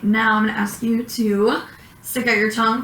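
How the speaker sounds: ordinary delivery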